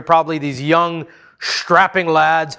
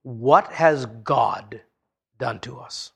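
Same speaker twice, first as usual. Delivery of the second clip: slow, softer, fading dread; fearful whisper; 5 semitones lower, 5 dB quieter, slower than usual. Acted. The brothers' first thought, a slow fearful hush. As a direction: let the question drain away in fear. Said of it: "Their immediate thought".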